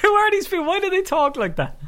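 high, squeaky laughing question. Real, not acted. Co-host baffled by the silly accent, laughing. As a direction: crack up while asking, voice shooting way up.

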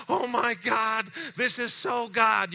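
high, loud and slow, overjoyed; ecstatic. Retold or imitated. Caller overjoyed that help is near, loud slow wonder. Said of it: "And he goes".

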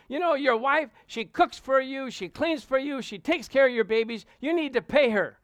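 high, loud, punchy pulpit delivery; pastor preaching. Retold or imitated. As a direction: preach it point by point to the congregation. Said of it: "The pastor was saying".

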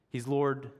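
usual speaking voice

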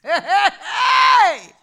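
a pastor shouting testimony, fired-up preaching; preaching at full force. Acted. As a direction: preach it hard to the congregation.

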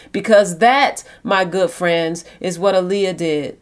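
normal talking voice

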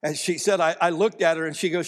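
usual speaking voice

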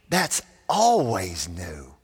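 "always" drawn out slowly with a swell; emphatic stress. Real, not acted. Preacher telling congregation the news is new, slow swelling stress on "always". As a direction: stretch and lean hard on the word "always". Preacher drives home the closing point.

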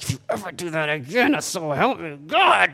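strained, distorted growl of a threat; mock threat in ear. Dad warning found daughter in her ear, mock-menacing. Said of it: "looking in her ear".

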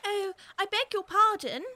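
quick, loud apologetic cry; hasty cry. A narrator's reading. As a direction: blurt the apology out in a rush. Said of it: "cried Alice hastily".

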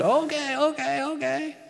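very high, hurried okay okay; nervous Moses. Retold or imitated. Moses backing off before the bush, high flustered okays. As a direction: squeak out a flustered yes to the bush.

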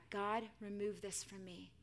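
subdued cry to God; soft, slowing plea under a heavy burden; weary plea. Acted. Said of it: "Paul cried out to God".

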